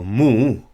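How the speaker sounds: "mu" slow, with the pitch lifted at the end; rising tone mu. Shown as a demonstration. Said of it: "representing the change in tone".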